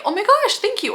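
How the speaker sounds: high, bubbly thank-you; girl in the hallway thanking a stranger, high and gushing; flattered girl. Acted. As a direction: squeal it like a flattered classmate. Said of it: "And she'll say".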